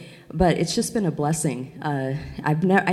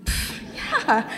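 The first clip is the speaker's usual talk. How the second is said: high, breezy overconfident voice, laughing; cocky self-mockery; 12 semitones higher, slower than usual. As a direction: act naively sure of yourself. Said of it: "I'm going".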